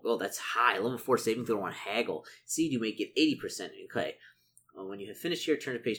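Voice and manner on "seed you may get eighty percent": ordinary talk, nothing marked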